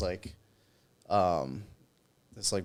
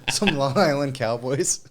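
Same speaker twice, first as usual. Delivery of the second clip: ordinary delivery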